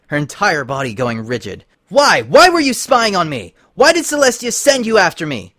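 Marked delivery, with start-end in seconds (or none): 1.9-5.5 high, loud, furious yelling; furious shout. Acted. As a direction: shout in rage. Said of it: "Twilight shouted".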